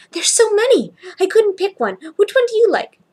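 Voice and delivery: put-on high, loud, thrilled little boy; excited child. Little boy showing the clerk a handful of bow ties, excited. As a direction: burst out with delight like a small kid.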